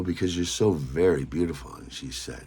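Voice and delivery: ordinary delivery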